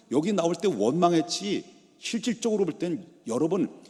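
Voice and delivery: ordinary delivery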